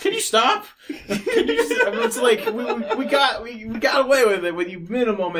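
high-pitched, helpless laughing plea; laughing protest. Real, not acted. Podcaster begging noisy co-host to stop, laughing protest. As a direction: protest through real laughter. Co-host keeps rustling, speaker cracks up.

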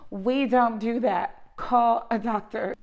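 loud, deliberate brush-off; firm loud cutoff. Retold or imitated. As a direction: shut the caller down, slowly and firmly. Cutting the caller off.

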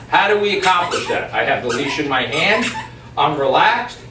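normal talking voice

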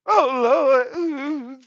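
normal talking voice

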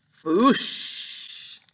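unvoiced whooshing puff of fire; a dragon's fiery foosh; fire whoosh sound. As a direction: blow out a long breathy foosh like dragon fire.

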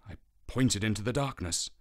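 ordinary delivery

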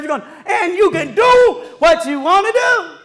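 high, loud preacher's call on sacrifice; full-force preaching. Acted. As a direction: shout it out to the congregation like a preacher in full flight.

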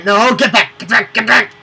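frantic high yelling; panicked shouting. Real, not acted. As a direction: shout them back in panic. Enemy coming up stairs.

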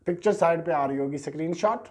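ordinary delivery

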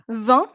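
"vingt" rising, no final T; silent T. Shown as a demonstration. Teacher asks class to hear a difference, silent T in "vingt". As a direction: end "vingt" on its nasal vowel. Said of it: "Quand je prononce".

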